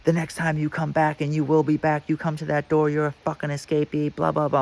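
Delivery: high mock drill-sergeant rant; drill instructor. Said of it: "in my face like a drill instructor".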